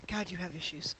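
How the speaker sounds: choking on water, coughing; sputtering after a wrong swallow. Real, not acted. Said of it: "Water went down the wrong pipe".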